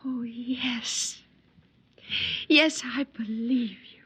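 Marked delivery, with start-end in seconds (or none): 0.0-1.2 breathy whispered yes. Acted. Girl whispering her yes to the wanderer. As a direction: barely voice it, awed. Girl answers softly, moved.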